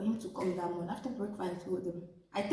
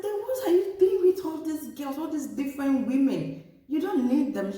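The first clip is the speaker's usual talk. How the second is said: incredulous outburst; 6 semitones higher, 5 dB louder than usual. Retold or imitated. Challenging the husband about his women.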